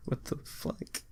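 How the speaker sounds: breathy laugh into a whisper; whispered disbelief. Real, not acted. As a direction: mutter it under your breath, laughing.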